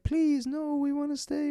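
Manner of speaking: very high, whiny mock begging; mock pleading. Rowdy party begging not to be kicked out, mock pleading. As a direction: imitate hecklers begging in a squeaky high voice.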